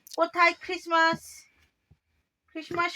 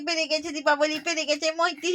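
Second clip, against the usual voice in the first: ordinary delivery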